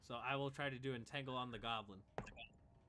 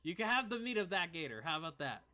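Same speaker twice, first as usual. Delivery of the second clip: high, loud counter-offer; placating in character; 5 semitones higher, 7 dB louder than usual. Acted. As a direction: haggle back loudly with an aggrieved comrade. Game master placates in character.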